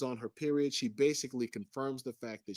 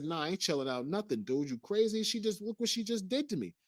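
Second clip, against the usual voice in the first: indignant, climbing high in pitch; worked-up protest, much higher; retold anger; 8 semitones higher, 3 dB louder than usual. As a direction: refuse to calm down, voice shooting up. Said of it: "[name] sees me getting angrier".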